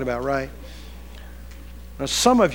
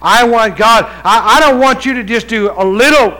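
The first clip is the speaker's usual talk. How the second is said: raised, louder pulpit prayer; impassioned plea; 5 semitones higher, 8 dB louder than usual. Acted. Preacher voicing prayer for a double portion, impassioned plea. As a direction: press God urgently for more.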